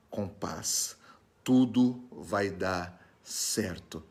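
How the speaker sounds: slow, measured soothing with long pauses; calm reassurance. Acted. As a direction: reassure gently, word by word. Voicing God's reassurance to the frightened.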